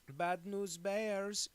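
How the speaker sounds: high, loud, very drawn-out character voice; Goldmember. Retold or imitated. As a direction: call out the reference, stretched and showy. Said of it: "because he'll say, like, you know".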